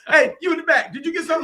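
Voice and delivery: high-pitched mock call to the back; acted crowd holler, very high. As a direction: playfully hail someone far away. Acting out calling to crowd.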